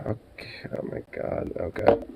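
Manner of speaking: whispered okay, oh my god; whispered disbelief. Real, not acted. Streamer stunned by the clip, whispering. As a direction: breathe it out in disbelief.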